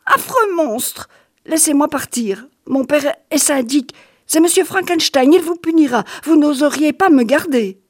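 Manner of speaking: put-on a boy clinging to his father's name; terrified boy threatening the monster; frightened child.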